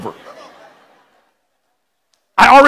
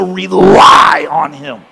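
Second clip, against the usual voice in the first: very slow, lower, stretched out; drawn-out emphasis; 6 semitones lower, much slower than usual. Real, not acted. Preacher driving home relying on God, very slow. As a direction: lean hard on each word. Sermon point.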